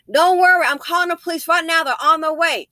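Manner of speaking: calling out through the door to a stranger; hollered through the door. Retold or imitated. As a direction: shout reassurance through a closed door. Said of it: "We can holler through the door".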